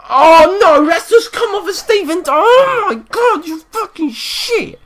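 real outraged howl; shocked shout. Real, not acted. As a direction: yell at the conceded goal. Conceding a goal, shouting.

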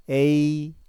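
에이 drawn out, starting on a clear 에; pronunciation model. Shown as a demonstration. Teacher demonstrates 에이 after the rule, long and level. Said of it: "단음모 으가 에로 발음납니다".

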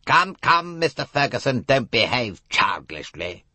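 chiding detective. A narrator's reading. Poirot rebuking the sulky young man, mildly scolding. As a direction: scold him like a child.

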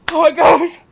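high, very loud exclamation; shocked outburst. Real, not acted. Player startled mid-fight, shocked shout. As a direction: burst out in shock.

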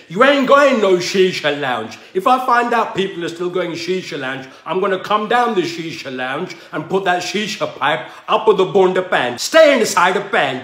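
loud scolding of youngsters going to shisha lounges; angry rant. Acted. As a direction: tell them off like a furious uncle. Ranting at shisha-goers.

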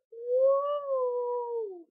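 high, loud, long woo; excited cheer. Real, not acted. Vlogger cheering school ending soon, loud woo. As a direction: cheer with a drawn-out whoop.

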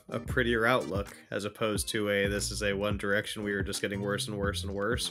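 normal talking voice

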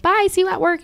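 high, sing-out farewell; see them off in a high chirp; chirpy goodbye. Retold or imitated. Woman seeing off unwanted coworker, high chirpy voice. Retold farewell to leaving guest.

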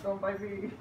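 ordinary talk, nothing marked

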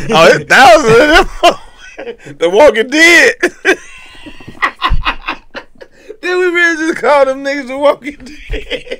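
words through high laughter; laughing. Real, not acted. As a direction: crack up while saying it. Laughing hard.

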